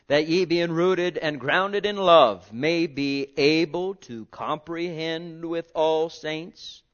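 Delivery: loud, slow, ringing scripture reading; preaching scripture. Acted. Preacher proclaiming verse to congregation, loud and slow. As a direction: proclaim the verse slowly to the church.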